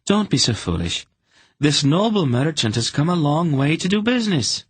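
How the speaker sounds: a lightly voiced daughter telling her father off; Anhelde snapping. A narrator's reading. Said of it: "Anhelde snapped".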